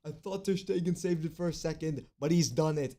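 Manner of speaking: high, loud goal celebration; ecstatic scream. Real, not acted. Goal scored, celebrating wildly.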